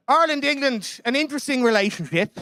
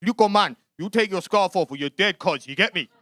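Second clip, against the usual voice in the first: threatening football fan. Retold or imitated. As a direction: square up like a cocky hooligan. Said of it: "Another fella comes up to me and he goes".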